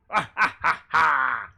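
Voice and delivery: loud, high evil cackle; evil villain laugh. Retold or imitated. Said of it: "I unironically have a evil villain laugh".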